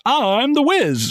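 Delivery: slow, drawn-out mock Wiz catchphrase; quoting the Wiz. Fan quoting the mattress guy's line, slow and drawn out. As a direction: stretch it out like the ad guy. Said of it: "I just knew him as, like, the Wiz".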